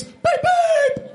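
high beeping horn noise; car horn beeps. Acted. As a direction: beep like a toy car horn.

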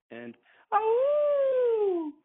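sharp high cry of pain; real startled ow; sudden yelp. Real, not acted. Voicemail caller yelps at the end.

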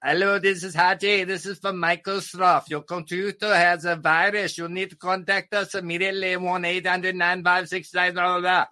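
high-pitched Indian scam caller impression; Indian accent. Comic imitating a tech support scammer, high Indian accent. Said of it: "Indian people that I see on T V".

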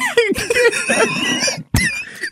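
very high squealing laugh; helpless laughter. Real, not acted. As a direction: lose it completely in high laughter. Reader cracks up.

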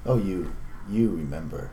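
ordinary delivery

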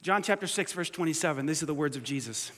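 ordinary talk, nothing marked

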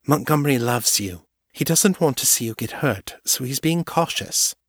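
commiserate with her friend gently; understated Morgan, torn; a touch of sympathetic Morgan. A narrator's reading. Morgan reassures Kate.

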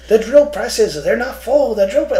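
high, loud whiny coworker voice; mock whiny coworker. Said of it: "If you're just like".

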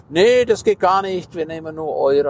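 lightly voiced firm refusal; mock refusal. Employee refuses the coin.

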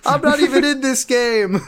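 high, loud character complaint; mock protest. Leon objects to being included, mock protest.

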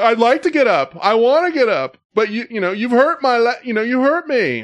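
high, loud, pleading whine; whiny fake victim. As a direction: ham up the wounded drunk. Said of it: "She's like".

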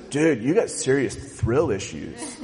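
low, slow, laid-back surfer drawl with a laugh; Crush the turtle. Retold or imitated. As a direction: chill sea turtle surfer dude. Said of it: "what does he say".

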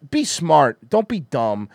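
ordinary delivery

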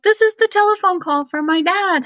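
high, loud, excited burst; light up as if the phone rang; thrilled realization. Retold or imitated. Thrilled at sign from dead dad.

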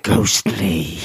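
low, drawn-out ghostly moan; hammed-up horror whisper; spooky voice. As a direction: put on a mock haunted voice. Spooky interjection about the haunted painting.

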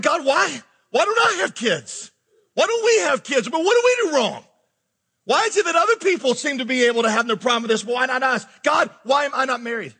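high-pitched, exasperated questioning; pleading with God. Retold or imitated. Pastor voices believers asking God why they are childless or single, pleading. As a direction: plead upward in mock anguish.

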